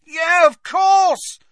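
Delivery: high, drawn-out shout; loud cry of revelation. A narrator's reading. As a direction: shout it slowly in sudden realisation. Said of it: "said Harry loudly".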